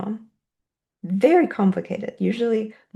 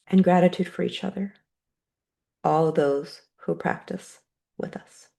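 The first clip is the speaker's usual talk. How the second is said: guided meditation. Acted. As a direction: guide the room gently into gratitude. Leads a closing gratitude meditation.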